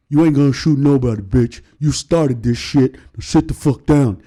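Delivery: lightly voiced Shaq, firm; a hint of Shaq shutting it down. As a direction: Shaq telling the heckler to sit down, firm. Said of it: "It was Shaq".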